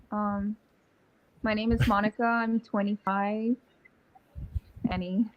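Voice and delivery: usual speaking voice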